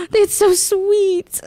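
swooning. Retold or imitated.

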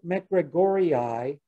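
"McGregorii" drawn out slowly, ending "ee-eye"; double-I ending "ee-eye". Shown as a demonstration. Lecturer demonstrating doubled terminal I's, ee-eye ending. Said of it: "Terminal I's are always pronounced I".

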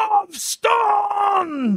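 high, loud mock yell; imitated shout. Retelling how the Salvation Army pastor yelled at him, high mock shout. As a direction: bark it like the angry pastor on the street. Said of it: "Han skrek".

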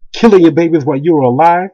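ordinary talk, nothing marked

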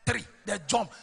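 normal talking voice

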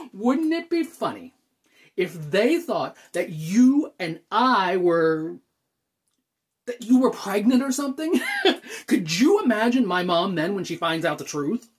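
loud, laughing speculation; amused. Acted. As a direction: get carried away by the joke, laughing. Jokes about parents assuming pregnancy.

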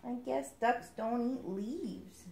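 slow, soft, sighing duckling; mild sigh. Acted. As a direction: let the words sag with a sigh. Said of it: "he sighed".

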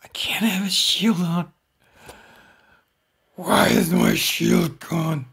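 high, loud, strained shout; desperate shout. Real, not acted. Gamer shouting in panic as his shield vanishes. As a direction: wail it out, panicking. Shield gone mid-fight.

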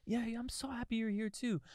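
very high, silly gushing; hammed-up tipsy sweetness; drunk affection. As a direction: answer in a squeaky, overjoyed voice. Acting drunk mutual affection.

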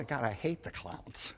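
normal talking voice

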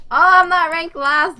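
loud, high, stunned outburst; can't believe the ranking; shocked disbelief. Real, not acted. Gamer shocked at own ranking.

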